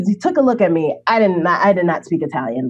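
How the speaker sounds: ordinary talk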